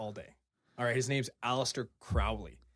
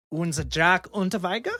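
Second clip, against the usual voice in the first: name said in a hammed-up German accent, higher and louder; best German accent; 5 semitones higher, 6 dB louder than usual. Shown as a demonstration. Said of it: "I'm gonna do it in my best accent".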